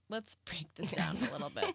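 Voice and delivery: unvoiced conspiratorial whisper; joking whisper. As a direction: lean in and whisper like a mock therapist. Host whispers, joking therapist mood.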